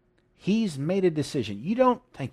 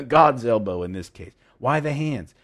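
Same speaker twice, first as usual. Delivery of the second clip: ordinary talk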